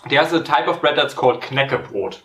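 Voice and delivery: normal talking voice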